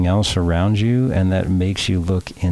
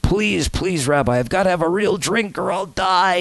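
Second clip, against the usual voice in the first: much higher, frantic begging; desperate cry; 11 semitones higher than usual. A narrator's reading. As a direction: cry out as if dying of thirst. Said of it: "he cries".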